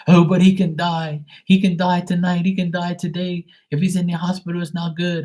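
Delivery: high-pitched mock panic; panicking mom. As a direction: fret frantically as an overdramatic mom. Mom panics about her brother.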